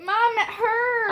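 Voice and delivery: loud, high cry of complaint; pained wail. Real, not acted. Child smacked too hard by mom, real pained wail. Child hurt during rough play.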